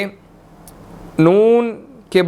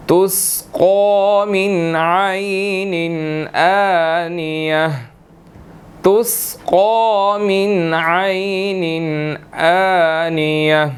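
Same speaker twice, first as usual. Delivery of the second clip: slow, drawn-out tajweed recitation; slow Quranic recitation; much slower than usual. Shown as a demonstration. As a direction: recite it slowly, stretching the vowels. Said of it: "teacher recites the verse".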